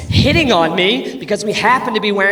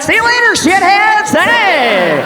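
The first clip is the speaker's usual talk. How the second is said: high, loud taunting shout down; gleeful yell; 11 semitones higher, 9 dB louder, slower than usual. Pretend. Man shouting down from a tall building, gleeful yell. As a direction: yell down from a rooftop. Yelling from rooftop.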